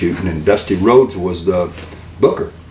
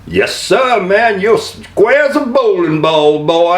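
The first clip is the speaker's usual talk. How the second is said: higher, slow blustering Southern rooster drawl; Foghorn Leghorn voice; 9 semitones higher, slower than usual. Acted. Showing the Foghorn Leghorn character Sid asked for, high and slow. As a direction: strut it out like the cartoon rooster. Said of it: "we want Foghorn Leghorn".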